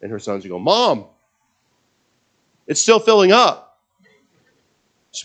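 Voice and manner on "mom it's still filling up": high, loud call to mother; astonished son. Acted. Preacher voices sons watching oil rise, astonished. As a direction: exclaim upward in mock amazement.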